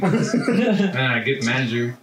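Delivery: usual speaking voice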